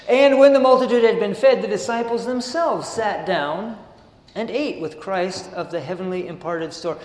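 measured sermon reading of the text; steady devotional reading voice; reading aloud. Acted. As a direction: read the passage out to the congregation. Reads the passage aloud.

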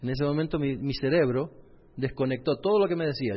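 ordinary talk, nothing marked

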